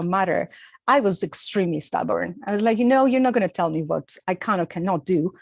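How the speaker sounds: usual speaking voice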